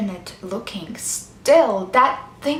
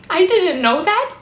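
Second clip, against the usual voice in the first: high, louder anguished admission; distressed protest; 7 semitones higher, 5 dB louder than usual. Acted. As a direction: protest it, voice rising at the end. Same admission, now in distress.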